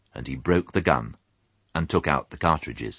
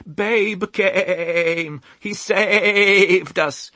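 very high, loud, slow exultant sheep voices; hymn of praise; 5 dB louder, much slower than usual. A narrator's reading. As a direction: sing his praises like a joyful flock. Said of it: "a hymn of praise".

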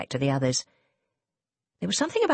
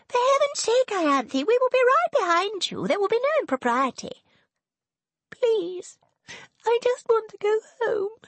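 much higher, exasperated then pleading; hammed-up plea to go home, much higher; wounded martyr. Serena playing wounded martyr.

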